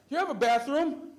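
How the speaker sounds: loud, high, drawn-out holler; really loud. Retold or imitated. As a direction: bellow the question across the whole room. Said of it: "She goes, really loud".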